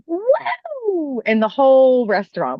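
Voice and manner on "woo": high whooping cheer; mock celebratory woo; imitated excited woo. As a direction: let out a big high woo. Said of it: "She does this".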